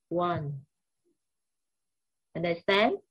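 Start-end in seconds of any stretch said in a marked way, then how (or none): none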